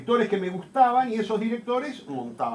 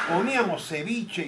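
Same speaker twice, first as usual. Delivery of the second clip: ordinary delivery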